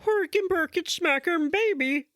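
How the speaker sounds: mock Swedish accent, pitched high; a hammy Swedish voice, high and loud. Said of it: "let me try one more time".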